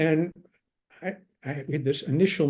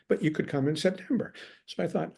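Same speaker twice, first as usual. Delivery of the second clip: ordinary talk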